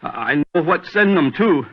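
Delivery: high, rising panic about the gunpowder; panicked fear. Acted. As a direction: voice climbing in terror. Terrified man fears being blown up.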